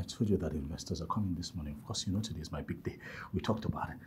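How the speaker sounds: whispering quickly into the phone; hushed phone whisper. Acted. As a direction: keep it hushed so the room can't hear. Phone call with investors arriving.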